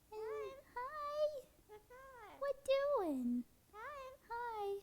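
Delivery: high squeaky pet voice, slow and sing-song. Real, not acted. Coos at the bird.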